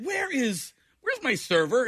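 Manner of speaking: imagined customer, pitched high; mock-puzzled, high-voiced search for the waiter; bewildered diner. Customer wondering where the arrested server went, mock bewildered.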